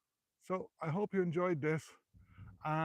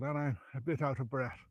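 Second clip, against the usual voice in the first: ordinary delivery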